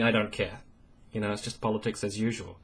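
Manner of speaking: quiet, fast, offhand shrug of a voice; dismissive Paul Ryan. Retold or imitated. Mocking Paul Ryan's indifference, quiet and fast. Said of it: "Paul Ryan responds by saying".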